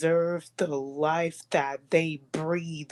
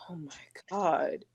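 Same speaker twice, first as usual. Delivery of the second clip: whispered in awe and sorrow; soft unvoiced whisper; 5 dB quieter than usual. Real, not acted. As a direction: breathe it out, barely voiced. Overwhelmed by the sad photo.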